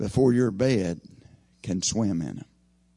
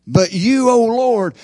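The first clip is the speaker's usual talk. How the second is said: high, loud, impassioned plea; loud cry to God; 10 semitones higher, 9 dB louder, slower than usual. Acted. Preacher voicing David's desperate cry to the Lord, loud plea. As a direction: call out to the Lord at full voice. Re-reads the psalmist's cry emphatically.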